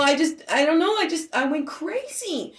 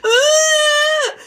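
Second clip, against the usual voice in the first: loud, very high wail; imitated child's scream; 7 dB louder than usual. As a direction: mimic a little girl's piercing cry. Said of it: "the little girl goes".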